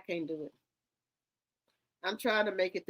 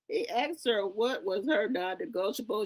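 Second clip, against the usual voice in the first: ordinary talk, nothing marked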